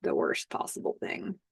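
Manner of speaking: low, hushed whisper. Retold or imitated. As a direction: breathe it out like a dire secret. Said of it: "He says".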